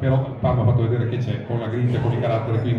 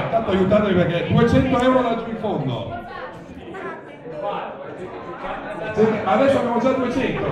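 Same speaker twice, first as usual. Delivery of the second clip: raised, ringing bid calls; auctioneer calling bids; 8 semitones higher, 3 dB quieter than usual. Real, not acted. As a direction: call out each bid to the room.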